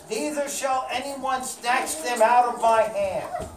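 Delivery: plain reading voice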